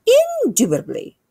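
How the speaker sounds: very high, loud queenly voice; emphatic regal queen. Acted. Queen agreeing about her spoon, high and emphatically regal. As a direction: proclaim it high and grand. Queen's repeated grand answer.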